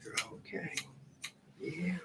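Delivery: whispered "You're okay" to the dog; soothing whisper. Real, not acted. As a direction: hush the dog gently. Owner soothing dog, whispered take.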